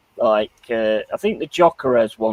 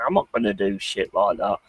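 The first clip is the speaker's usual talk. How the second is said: ordinary talk, nothing marked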